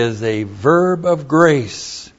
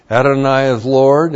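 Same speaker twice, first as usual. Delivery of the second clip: ordinary delivery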